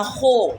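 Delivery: خو said firmly from the throat, held crisp; correct letter sound. Shown as a demonstration. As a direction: give خو its throaty rasp from the back. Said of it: "کیسے پڑھنا ہے".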